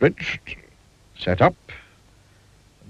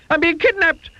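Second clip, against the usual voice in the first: very high, breaking off mid-wail; frantic panic; slower than usual. A narrator's reading. Mister Jelly fearing ruffians, frantic high wail. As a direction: wail then stop short. Panics under falling leaf.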